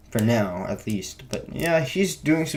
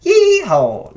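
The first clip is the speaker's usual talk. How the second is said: very high, loud cowboy whoop; exaggerated cowboy accent; 8 dB louder than usual. Reviewer overdoing the movie's southern accent, exaggerated cowboy whoop. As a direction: whoop it like a hammed-up Texan cowboy. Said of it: "they didn't go like that".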